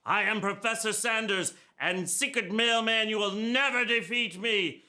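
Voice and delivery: high-pitched theatrical villain; high, hammed-up villain declaration; villain's threat. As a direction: taunt the hero in a high put-on voice. Performs villain threatening the hero.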